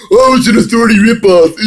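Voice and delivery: high, loud, hammed-up whining; mocking high-pitched complaint; mock whiny fans. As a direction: whine it like an outraged nerd, ending in a squeal.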